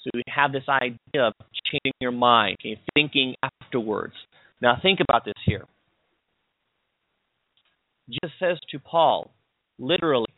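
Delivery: ordinary talk, nothing marked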